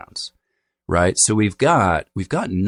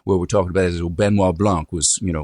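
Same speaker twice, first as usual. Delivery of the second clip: put-on old-fashioned Southern accent. Shown as a demonstration. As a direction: demonstrate antique Southern with dropped R's. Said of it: "very old-fashioned kind of non-rhotic Southern accent".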